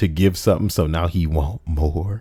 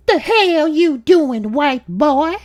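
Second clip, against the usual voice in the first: much higher, slow, drawn-out taunt; a rasping mocking woman; crackhead neighbor voice; 6 dB louder, slower than usual. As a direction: neighbor taunting the narrator from upstairs.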